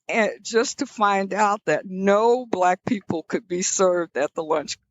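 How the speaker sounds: ordinary delivery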